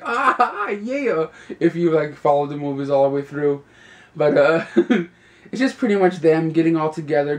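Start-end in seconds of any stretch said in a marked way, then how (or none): 0.0-1.3 high, pumped-up cheer; excited. Pretend. Fan of the series cheering at the ending, high and excited. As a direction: cheer like a fan who finally gets it.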